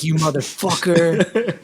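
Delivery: slow, drawn-out mock fury; livid curse. Cursing out his manager, slow mock fury. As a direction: stretch the curse out in disbelief. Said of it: "I was livid".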